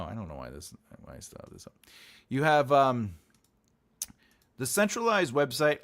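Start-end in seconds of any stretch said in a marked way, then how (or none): 0.0-1.7 muttered aside. Real, not acted. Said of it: "muttered aside to himself".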